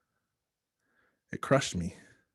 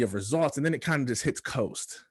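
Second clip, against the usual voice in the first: ordinary talk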